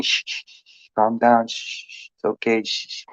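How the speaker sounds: put-on soft soothing shh to a baby; rhythmic shushing. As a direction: hush a crying baby gently. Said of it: "you're shushing them".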